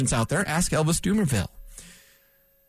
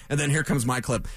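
ordinary talk

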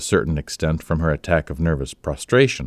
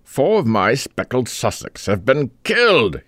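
exclaiming; 7 semitones higher than usual. A narrator's reading. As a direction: blurt out the bad news in dismay. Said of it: "he exclaimed".

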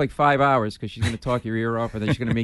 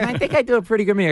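much higher imitated Leno voice; Jay Leno impression; 10 semitones higher, 3 dB louder, faster than usual. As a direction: insist it's him, in a high Leno voice. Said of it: "You do a lousy Jay Leno".

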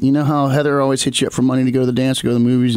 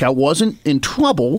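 ordinary talk, nothing marked

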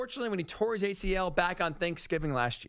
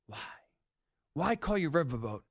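ordinary talk, nothing marked

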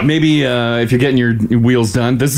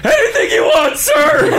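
simpering; slower than usual. Pretend. Mocking deference to giant dad.

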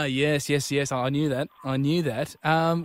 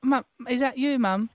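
put-on high, small, hesitant child voice; little English boy; 8 semitones higher than usual. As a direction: pretend to be a timid little boy on the phone. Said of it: "He's a four-year-old little guy".

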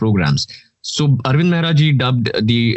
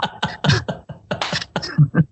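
laugh; 7 semitones higher, 4 dB quieter than usual. Real, not acted. Laughs after improv.

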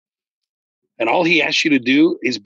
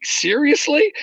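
incredulous; slower than usual. Retold or imitated. Retelling a pastor's demon claim.

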